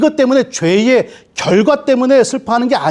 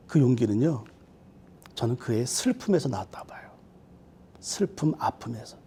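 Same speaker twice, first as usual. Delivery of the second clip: softer and softer until only breath is left; hushed whisper; 6 semitones lower, 12 dB quieter than usual. Real, not acted. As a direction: drop to a soft whisper. Pastor drops to a whisper.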